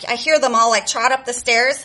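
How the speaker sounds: ordinary talk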